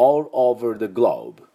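all over the globe said slowly and clearly; slow, careful model of all over the globe; model phrase. Shown as a demonstration. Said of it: "こういう音になります".

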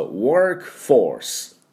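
ワークフォース said slowly, like the English word workforce; teacher modelling ワークフォース slowly in English; careful English pronunciation. Shown as a demonstration. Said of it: "これちゃんと言いますと".

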